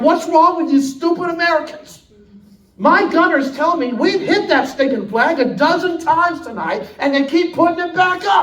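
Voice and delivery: a hint of a furious admiral, high and loud; British admiral losing patience; angry admiral. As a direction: snap at those stupid Americans. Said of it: "he's like".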